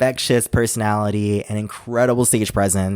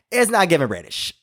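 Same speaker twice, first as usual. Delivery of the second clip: ordinary talk, nothing marked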